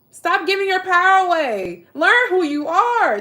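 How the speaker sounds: high and loud, urging the viewers; urgent exhortation. Real, not acted. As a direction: preach it at them, slowly.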